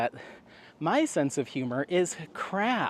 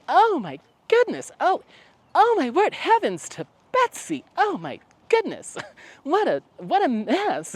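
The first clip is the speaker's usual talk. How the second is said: much higher, louder flustered "heavens to Betsy" fuss; flustered old-fashioned lady; 14 semitones higher, 5 dB louder than usual. Retold or imitated. Annie panicking as the curtains catch fire, high flustered fussing. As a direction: fuss and fret over the fire in a shrieky high voice. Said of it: "She's like".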